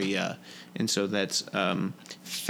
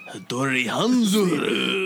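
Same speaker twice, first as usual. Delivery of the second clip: dramatic announcement; 3 semitones higher, 5 dB louder, slower than usual. Pretend. Dramatic drawn-out name.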